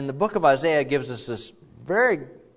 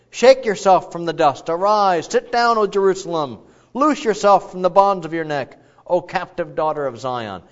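raised, ringing reading of the prophet; rousing scripture proclamation; 4 semitones higher, 6 dB louder than usual. Acted. Preacher calls Jerusalem to wake, in a ringing voice. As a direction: call Jerusalem to rise up.

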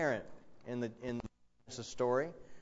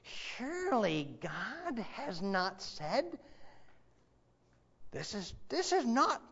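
Frank Peretti's serpent; 8 semitones higher, slower than usual. Acted. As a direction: play the lying serpent, pitched way up and teasing. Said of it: "Frank Peretti does this great impression".

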